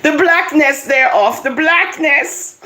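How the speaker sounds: high, exultant exclamation; thrilled, breaking into laughter; all excited. Real, not acted. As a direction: savour the words in high-pitched delight. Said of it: "I got all excited".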